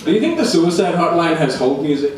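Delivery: ordinary delivery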